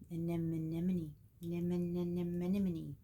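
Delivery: slow, stretched-out "anemone" with extra repeated nim-nem syllables; drawn-out garbled "anemone", tongue tangled on the n's and m's; tongue-tied babbling. Real, not acted. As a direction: trip over "anemone", looping its middle syllables. Tongue-tied, playing with stumbled word.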